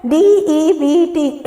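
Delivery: slow letter-by-letter reading; spelling out. Shown as a demonstration. Teacher reading the fifth word's spelling, spelling out. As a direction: spell out each letter slowly. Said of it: "इसमें देखिए हम लोग silent बी है".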